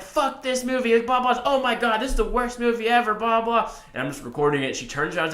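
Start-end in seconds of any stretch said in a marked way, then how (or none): 0.0-3.7 high-pitched mock ranting; imitated heckler's rant. Mimicking an outraged moviegoer during the film, high mock rant. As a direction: rail at the film in a high, put-on voice. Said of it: "they're just yelling".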